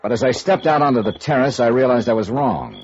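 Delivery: steady report-style narration over music; story narration. Acted. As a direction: tell the case like an expense report. Detective narrates his report.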